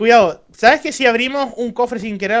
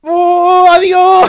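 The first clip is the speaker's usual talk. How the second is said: excited shouting; 9 semitones higher, 11 dB louder, much slower than usual. Real, not acted. Enemy knocked off.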